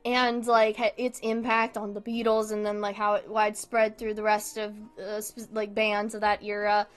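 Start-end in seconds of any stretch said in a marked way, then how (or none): none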